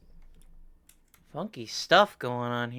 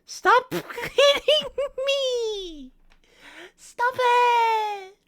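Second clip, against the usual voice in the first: very high, loud mock wail; drawn-out, playfully distressed shriek; squealed protest; 9 dB louder, much slower than usual. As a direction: squeal it like a pestered puppet. Puppet protests at being hit.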